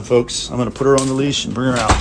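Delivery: normal talking voice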